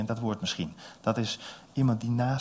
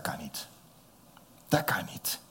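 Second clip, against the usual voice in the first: soft, then whispered dat kan niet; hushed disbelief; 7 semitones lower, 7 dB quieter than usual. Acted. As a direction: breathe it out in awe. Frightened disciples in disbelief. (fluisterend ongeloof)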